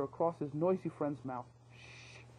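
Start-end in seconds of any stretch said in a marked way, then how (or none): 1.7-2.2 urgent hush. Acted. Hushing the noisy friend.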